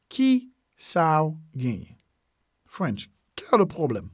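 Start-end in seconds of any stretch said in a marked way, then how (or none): 0.1-2.0 "Ki... sa ou... genyen", with clear gaps; word by word. Shown as a demonstration. Teacher repeating a Creole phrase for learners, word by word. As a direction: space out each piece for learners.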